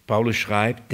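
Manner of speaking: normal talking voice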